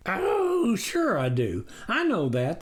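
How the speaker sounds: high "Oh", smooth agreement; visitor agreeing. A narrator's reading. The wolf humouring the farmer. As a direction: humour him. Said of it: "the hairy visitor agreed".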